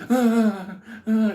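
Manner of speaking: high, loud cries of pain; hammed-up screaming in agony; imitated screams. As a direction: mimic a victim wailing. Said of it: "And he's".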